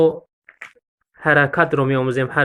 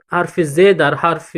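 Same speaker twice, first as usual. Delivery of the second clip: ordinary talk, nothing marked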